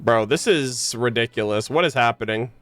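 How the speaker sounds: usual speaking voice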